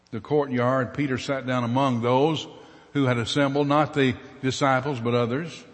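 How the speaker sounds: normal talking voice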